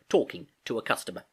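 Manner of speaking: plain reading voice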